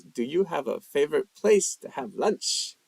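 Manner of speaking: ordinary talk, nothing marked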